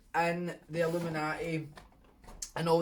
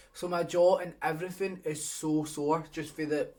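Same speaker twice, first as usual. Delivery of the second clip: ordinary talk, nothing marked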